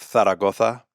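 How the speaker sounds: "Zaragoza" with TH for both Zs; Castilian lisp. Shown as a demonstration. Giving a town as example, Castilian lisp. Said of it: "they use a lisp on words with Z's and C's".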